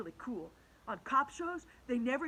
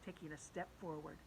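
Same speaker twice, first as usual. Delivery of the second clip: usual speaking voice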